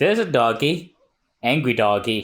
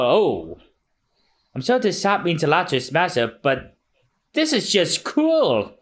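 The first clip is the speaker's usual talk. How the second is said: lightly voiced pitying townsfolk; 9 semitones higher than usual. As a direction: feel sorry for the dog. S1 voices character.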